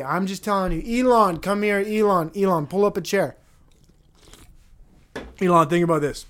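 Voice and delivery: urging him to come sit down; calling Elon over. Acted. Speaker calls Elon over to hear the plan, loud and insistent.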